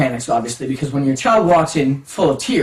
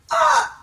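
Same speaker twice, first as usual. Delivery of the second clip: high-pitched dismayed yelp; mock gasp of shock. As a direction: let out a high, mock-horrified cry. Retells shock at being lied to.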